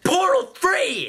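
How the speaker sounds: high, loud, slow mock cry; mock shout. Mocking sequel names with a high wailing shout. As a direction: wail the title out.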